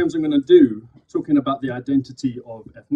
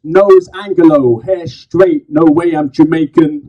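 loud, higher, punchy slam poetry delivery; spoken word poem; 5 semitones higher, 8 dB louder, slower than usual. Acted. Poet performing to a live crowd, loud slam declamation. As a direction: declaim each phrase to the crowd.

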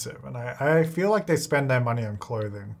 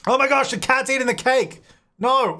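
startled; 10 semitones higher than usual. Real, not acted. Cat eating the cake.

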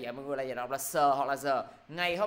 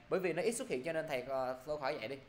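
normal talking voice

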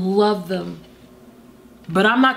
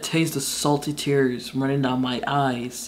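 soft quoting; 8 semitones lower, 6 dB quieter than usual. Retold or imitated. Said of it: "But she said".

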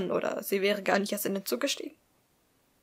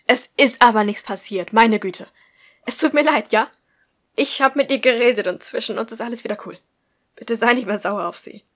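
louder, exasperated plea; pleading loudly; 2 semitones higher, 11 dB louder than usual. Acted. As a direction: beg him, raising the voice. Tobias pleads loudly on phone. (Tobias fleht Thaddeus laut und genervt an)

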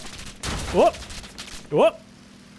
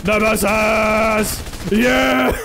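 drawn-out, higher yell; slow, triumphant holler; excited battle cry; 4 semitones higher, 4 dB louder, much slower than usual. Real, not acted. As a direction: shout it with glee as you attack.

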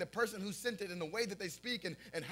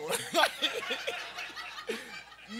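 high laugh; 8 semitones higher, 4 dB louder than usual. Real, not acted. Laughing at own story.

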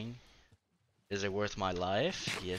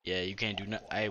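usual speaking voice

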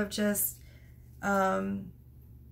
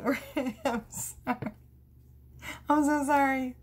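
real laughter, sighing to recover; weak from laughing; laughing to tears; 4 semitones higher than usual. Real, not acted. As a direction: apologise through helpless giggles. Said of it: "I'm crying".

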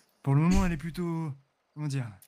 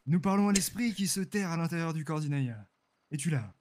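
solemn séance voice. Acted. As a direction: call to the spirit gravely. Leads the séance ritual.

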